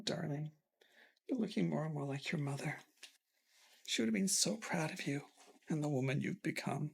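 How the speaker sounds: soft, weak, gentle comfort; ailing father comforting his daughter tenderly. Acted. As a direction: reassure her softly from the sickbed.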